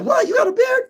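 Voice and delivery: high mock falsetto of amazed people; mock surprise. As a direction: squeal it in disbelief. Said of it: "people would be like".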